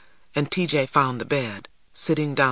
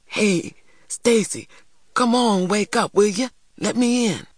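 higher-pitched urgent call through a door; pleading, raised-pitch call at the door; soft calling; 7 semitones higher than usual. A narrator's reading. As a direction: call a friend awake without waking the house. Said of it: "calling softly".

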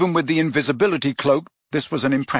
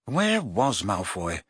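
ordinary delivery, nothing marked

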